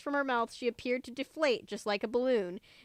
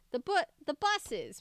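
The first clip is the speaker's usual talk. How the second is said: crying out; 7 semitones higher than usual. A narrator's reading. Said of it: "Mom cried".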